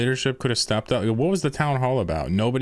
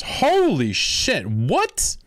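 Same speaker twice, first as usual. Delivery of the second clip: drawn-out, much higher shocked gasp of words; stunned outburst; 10 semitones higher, much slower than usual. Real, not acted. Streamer hearing the alliance is closing, stunned. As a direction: let the shock stretch it out slowly.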